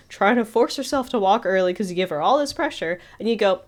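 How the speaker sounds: ordinary talk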